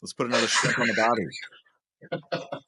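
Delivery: hammed-up movie catchphrase; film character quote. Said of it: "He's like".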